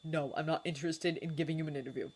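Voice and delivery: snapped. A narrator's reading. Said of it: "snapped Harry".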